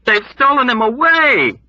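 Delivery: high, loud shout; loud alarmed shout. Acted. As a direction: shout it back in horror. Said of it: "Dunbar shouted back".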